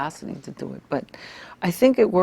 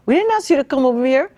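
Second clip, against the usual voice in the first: much higher, louder peak of the retold rant; retold outburst; 9 semitones higher, 8 dB louder than usual. To critics who wanted Hawaiians silent, a high loud outburst. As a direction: let the last get out ring out, high and loud.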